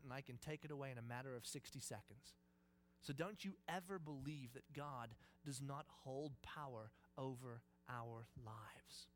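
low, soft, weighty voice; hushed assurance of power; quiet, intimate God. Acted. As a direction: speak softly into his heart with total authority. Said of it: "here God whispers to Moses".

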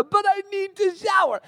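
high, put-on teenage wail; whining daughter imitation. Daughter told to get up, mock wailing protest. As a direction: protest in a mock girlish whine.

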